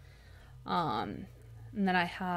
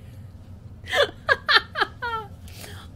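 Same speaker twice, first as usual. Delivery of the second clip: high breathless laugh. Real, not acted. Bursts out laughing at mistake.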